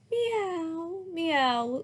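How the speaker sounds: child playing a kitten; kitty meow. A narrator's reading. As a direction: mew like a small cat. Girl pretending to be kitty.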